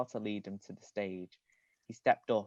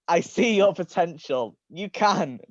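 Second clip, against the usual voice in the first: coldly; 7 semitones higher, 6 dB louder than usual. A narrator's reading. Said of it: "Balthazar replied coldly".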